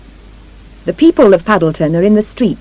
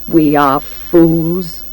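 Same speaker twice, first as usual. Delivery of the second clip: very slow, rueful conclusion; slow, deliberate confession; rueful admission; 3 semitones lower, much slower than usual. A narrator's reading. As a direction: draw each word out regretfully. Man ruefully repeats his admission.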